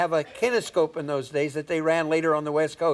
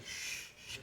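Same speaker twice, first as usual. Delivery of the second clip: put-on a whispered shush at a noisy kid; 19 dB quieter than usual. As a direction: hush someone, whispered. Audience shushing him in balcony.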